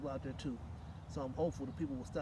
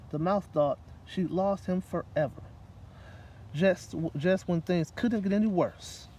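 put-on reading to children; 6 dB louder than usual. A narrator's reading. Reading a picture book aloud to children at story time. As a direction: read it aloud to a room of kids.

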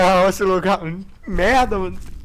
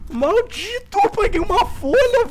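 high-pitched triumphant shout; excited high cry; 3 dB louder, slower than usual. Real, not acted. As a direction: squeal with relief. Excited at finding page.

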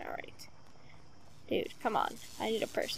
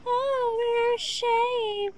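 high, slow mock lament; mock shame; 9 semitones higher, much slower than usual. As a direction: sing out a fake shame. Mock remark on players.

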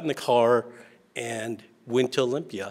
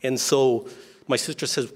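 ordinary talk, nothing marked

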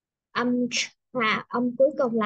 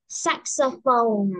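saxophone drawn out very slowly; slow model word; 3 dB louder, much slower than usual. Shown as a demonstration. Teacher modelling saxophone for repetition. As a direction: stretch saxophone for the class to copy. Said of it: "repeat after me".

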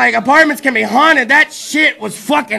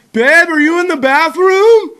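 slow, louder call through the house; 5 semitones higher, 5 dB louder, slower than usual. Retold or imitated. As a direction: call out to break the tension. Calling out to girlfriend.

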